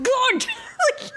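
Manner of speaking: high, laughing, exasperated outburst. Retold or imitated. Fan scolding Scott for moping, high exasperated laugh. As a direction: throw your hands up at him, half laughing.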